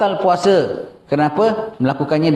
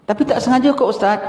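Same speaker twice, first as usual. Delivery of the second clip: higher-pitched voice of a questioner objecting; protesting layperson; 8 semitones higher, faster than usual. Acted. Layperson tells the ustaz it was unintended, high-pitched protest. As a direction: plead innocence in a raised pitch.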